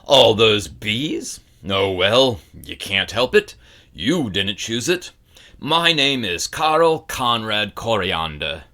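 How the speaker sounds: put-on loud, gruff growl of a grumpy old man; gruff old bookseller. A narrator's reading. Old bookseller lectures the boy, loud gruff growl. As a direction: growl it like a cranky shopkeeper who hates children.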